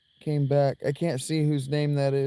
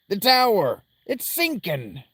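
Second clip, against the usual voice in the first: alarmed cry; 8 semitones higher, 4 dB louder than usual. Acted. Voicing character alarm.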